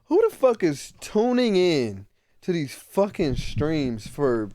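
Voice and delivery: ordinary delivery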